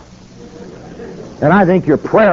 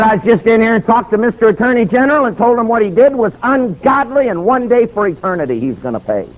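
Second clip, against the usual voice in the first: high-pitched indignant preacher, dropping at the end; righteous declaration; 8 semitones higher than usual. Retold or imitated. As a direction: proclaim it boldly to the reporters. Preacher boldly tells reporters off.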